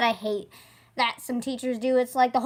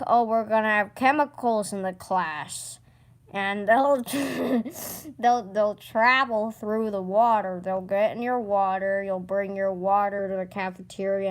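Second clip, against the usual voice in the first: low droning mock teacher voice; 7 semitones lower, 4 dB quieter than usual. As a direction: drone it out like a bored teacher. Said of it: "the water rule is like".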